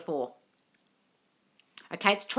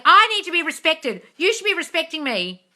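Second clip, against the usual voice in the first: entitled demand; 9 semitones higher, 7 dB louder than usual. Retold or imitated. Mocking demanding partner.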